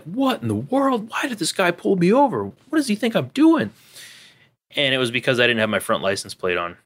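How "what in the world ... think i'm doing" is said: baffled inner thought. Retold or imitated. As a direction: act out the confusion of being pulled over. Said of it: "I'm like".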